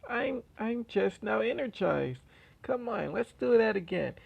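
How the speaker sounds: giddy and wired, egging him on; gleeful Toga. Acted. Toga teasing worn-out Deku, high giddy taunt. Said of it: "And she's like".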